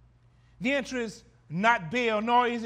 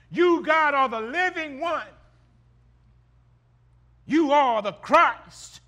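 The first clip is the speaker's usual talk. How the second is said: loud, climbing shouts of faith; preaching at full force; 3 semitones higher, 7 dB louder than usual. Acted. Preacher crying out Peter's confession to the congregation, full-force preaching. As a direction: preach it to the rafters.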